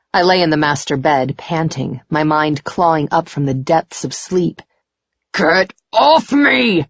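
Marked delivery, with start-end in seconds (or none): 5.3-6.9 high, slow, strained cry; nightmare outcry. A narrator's reading. Man trapped in his nightmare, crying out. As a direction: cry out as if fighting off an attacker.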